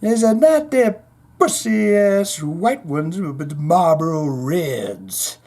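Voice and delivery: slow, drawn-out showman voice. Acted. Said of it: "[name] isn't having any of that".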